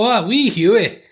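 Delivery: high, loud, drawn-out mock Scottish; worst Scottish accent. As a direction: stretch it out in a terrible Scottish accent. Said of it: "that's my worst Scottish accent".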